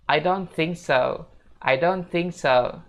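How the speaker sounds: careful, drawn-out "I don't think so"; pronunciation model. Shown as a demonstration. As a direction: space out "I don't think so" for learners. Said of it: "Jadinya bakal kayak gini".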